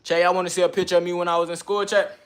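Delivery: loud, high call to chat. Real, not acted. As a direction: shout it out to the stream. Shouting at chat.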